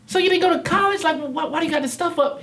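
high-pitched, baffled retold question; incredulous questioning. Guest asking successful man about college, incredulous. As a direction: question him in squeaky disbelief. Acts out his astonished question.

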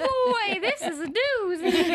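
high, loud goofy puppet voice; goofy muffled sock puppet. Pretend. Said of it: "from a puppet voice".

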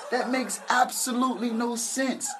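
normal talking voice

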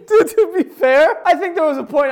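loud laughing through the words. Real, not acted. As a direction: burst out laughing while talking. Laughing loudly.

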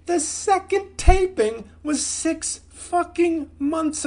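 furious shout. Real, not acted. Rant about delayed special.

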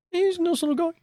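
high British narrator voice; mock UK accent. Host closing his alien story in a high mock British voice. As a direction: wrap up the tale in a silly British accent. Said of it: "our various UK accents".

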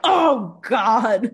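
mock dismay at oversleeping; groaning self-reproach. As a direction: blurt it out at yourself. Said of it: "You're like".

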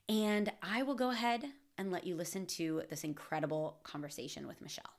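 ordinary talk

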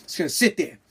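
ordinary talk

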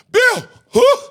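high, loud, frantic; yelling for help with the dog; panicked Tony. Retold or imitated. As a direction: friend in a panic being dragged. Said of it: "Two minutes later".

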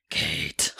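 whispered eerie demon voice. Acted. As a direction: breathe it like a disembodied spirit. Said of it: "That was a really good demon voice".

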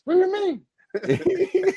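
high, slow, laughing what do you mean; laughing incredulous question; mock disbelief. Real, not acted. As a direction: squeal it out in amused disbelief. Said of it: "mock disbelief while laughing".

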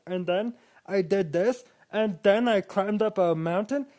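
a bragging climber, hammed up and pitched high; mocking himself. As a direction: lampoon a boring solo storyteller. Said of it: "It's more than just me being like".

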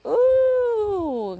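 loud, high whooping ooh; high drawn-out ooh. Real, not acted. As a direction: let out a long rising-pitched ooh. Exclaims while pacing to wake up.

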